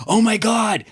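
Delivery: high, gushing starstruck fan; excited fan. Retold or imitated. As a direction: gasp it out like a starstruck tourist. Fans seeing the lookalike.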